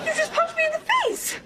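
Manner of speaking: high-pitched indignant outcry; shocked protest. Acted. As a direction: exclaim it, pained and aghast. Protests being punched.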